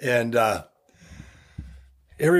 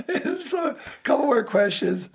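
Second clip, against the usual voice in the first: laughing; 11 semitones higher than usual. Real, not acted. Still laughing after punchline.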